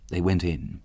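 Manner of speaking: ordinary delivery, nothing marked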